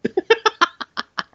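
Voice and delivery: squealing high laughter. Real, not acted. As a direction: burst out in a squeal. Laughing hard.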